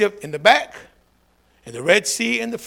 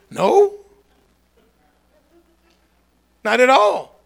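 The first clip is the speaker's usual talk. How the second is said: much higher, loud refusal; sharp, loud rejection; emphatic no; 10 semitones higher, 7 dB louder, much slower than usual. Real, not acted. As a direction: shut the idea down hard. Firm answer to taunt.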